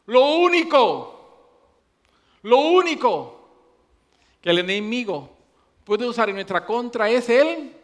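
loud, high preacher's build-up; preaching at full force. Acted. Preacher warning the congregation, loud and high. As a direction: drive the warning home to the church.

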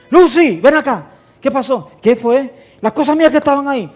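calling the wife, high and loud; imitated angry call. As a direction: shout across the house, demanding. Acted quarrel with his wife. (llamado enojado imitado, agudo y fuerte)

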